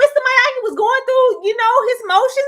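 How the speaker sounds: high, loud, fast indignant defence; heated defence. Real, not acted. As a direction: argue it like it's personal.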